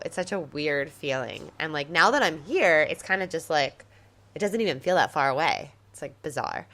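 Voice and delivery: ordinary delivery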